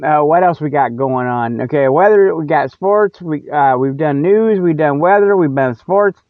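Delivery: ordinary talk, nothing marked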